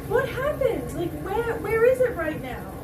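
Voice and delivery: real bewildered distress. Real, not acted. Makeup ruined by tears.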